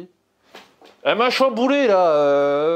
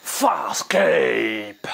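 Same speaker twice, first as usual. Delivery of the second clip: annonce du titre; 6 semitones lower than usual. Acted.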